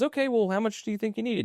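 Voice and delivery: high, quick mock-incredulous banker voice. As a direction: play the banker, amused at the request. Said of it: "He goes".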